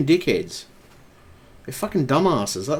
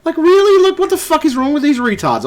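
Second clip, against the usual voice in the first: much higher, louder furious rant; exasperated outburst; 12 semitones higher, 4 dB louder than usual. Real, not acted. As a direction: blow up at the idiots. Ranting at Facebook security.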